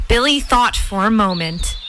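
usual speaking voice

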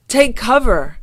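high, loud command to hide; shouted warning. Acted. Fieldgar warns Garfield of the Cosmots, shouted warning. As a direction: call out urgently. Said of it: "Fieldgar shouted".

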